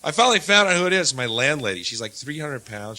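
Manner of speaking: normal talking voice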